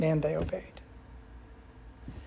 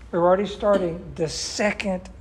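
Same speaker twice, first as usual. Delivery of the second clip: ordinary talk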